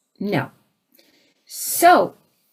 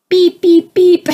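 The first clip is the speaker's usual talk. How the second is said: high, loud electronic beeps; imitating a beep; 9 semitones higher, 9 dB louder, slower than usual. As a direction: beep like a shrill alert. Mystery beeping again.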